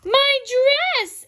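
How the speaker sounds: high, loud cry of dismay; horrified storybook shriek; exclaiming. A narrator's reading. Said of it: "exclaimed Missus Rogers".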